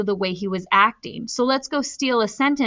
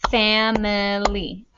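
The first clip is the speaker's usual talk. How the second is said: slow, syllable by syllable; clapping syllables; slower than usual. Shown as a demonstration. Teacher clapping syllables with students, slow and even. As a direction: split it into beats: fam-i-ly. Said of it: "You guys can clap the syllables".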